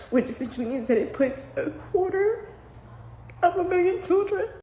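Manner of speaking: holding back sobs. Real, not acted. Tearful about children in limbo.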